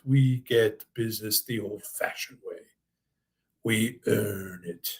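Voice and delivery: lightly imitated English accent, slowing down on the last words; a hint of an old English actor in an ad slogan; deep English accent. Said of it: "said in his deep English accent".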